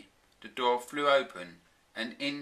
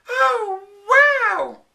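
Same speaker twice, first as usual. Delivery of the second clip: very high, loud gasp of wonder; gasping wow; 16 dB louder than usual. A narrator's reading. Flora spotting the secret lever, high loud gasp. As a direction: gasp in delighted surprise. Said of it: "she gasped".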